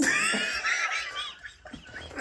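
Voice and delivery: real high wheezing laugh; helpless laughter. Real, not acted. Storyteller losing it over daughter's question, squealing laughter. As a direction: crack up, long and squealing.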